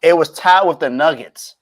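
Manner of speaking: loud, slow and high, stunned at the pick; disbelieving emphasis on the Nuggets; incredulous. Real, not acted. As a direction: make him hear how absurd the Nuggets comparison is. Incredulous at partner's prediction.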